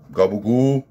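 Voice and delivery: hammed-up Italian-American mobster impression; Silvio Dante. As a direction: play a comic Sopranos wiseguy. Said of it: "and he's like".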